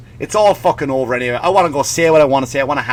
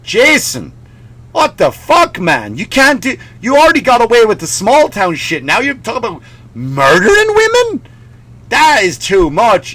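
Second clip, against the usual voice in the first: mock outrage; 6 semitones higher, 3 dB louder than usual. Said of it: "People are like".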